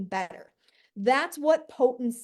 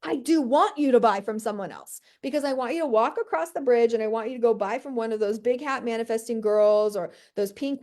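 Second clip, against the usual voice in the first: normal talking voice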